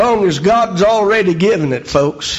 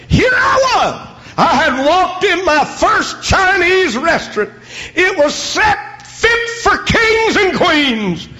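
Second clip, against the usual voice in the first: preaching at full force; 12 semitones higher than usual. Acted. Preacher shouting his testimony to the congregation. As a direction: let it burst out with joy.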